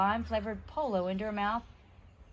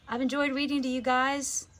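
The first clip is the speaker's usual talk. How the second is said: friendly sign-off; 4 semitones higher, 4 dB louder than usual. Real, not acted. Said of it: "reader signs off to listeners".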